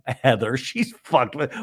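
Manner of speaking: normal talking voice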